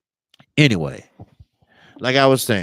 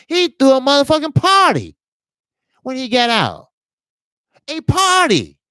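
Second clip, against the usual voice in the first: outraged; 10 semitones higher, 4 dB louder than usual. Real, not acted. Outrage at killer's party.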